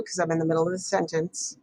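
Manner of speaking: normal talking voice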